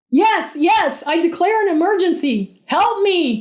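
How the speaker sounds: high, loud desperate scream; frantic high plea for help. A narrator's reading. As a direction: scream into the headset mic. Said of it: "Kevin screamed into the little plastic microphone".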